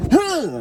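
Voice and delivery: sharp high grunt; punch grunt. Retold or imitated. Mimed punch.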